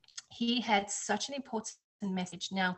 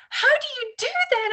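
imitating an amazed man, much higher and louder; disbelieving question, pitched way up; amazed neighbor; 12 dB louder than usual.

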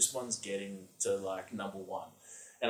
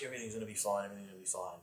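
reassuring cop; 9 dB quieter, much faster than usual. Retold or imitated. Police officer reassuring him.

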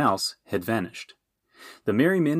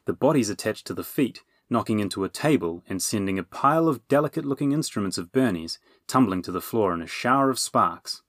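usual speaking voice

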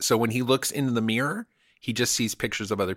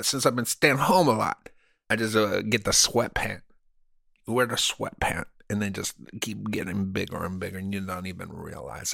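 mock Cuban accent. Comedian bragging to cohost about being inside Hollywood, in a mock Cuban accent. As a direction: play a fake Hollywood Cuban tough guy in a Cuban accent. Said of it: "I was using an accent".

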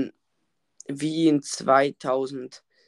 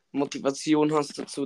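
usual speaking voice

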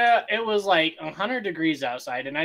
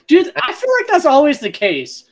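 excited; 10 semitones higher, 10 dB louder than usual. Real, not acted. Loud excited agreement.

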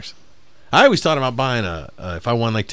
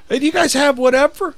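much higher, dopey customer voice; mock dumb customer; 14 semitones higher than usual. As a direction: play a clueless customer asking.

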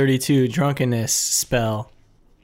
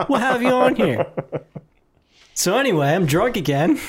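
usual speaking voice

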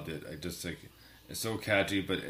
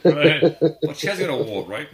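high, loud mock-English protest; horrible English accent; 9 semitones higher, 14 dB louder than usual. Host finishing a comedy quote to the co-host, horrible English accent. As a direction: do a badly faked English accent, raised and loud. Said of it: "switching my horrible English accent".

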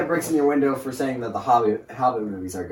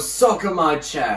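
slow, raised mock-British voice; pretend British; 5 semitones higher, slower than usual. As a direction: absurd British kill-comms taunt. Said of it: "pretend to be British and just start saying".

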